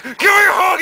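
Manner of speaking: high, loud demand; dad yelling. Acted. Son acts out dad bursting in for a hug, yelling. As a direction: holler it way up high.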